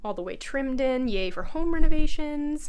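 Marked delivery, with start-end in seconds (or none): 1.1-2.7 loud, high mock yay; sarcastic cheer. Real, not acted. Showing viewers her untrimmed window, a mock cheer. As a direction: cheer it with a wry roll of the eyes.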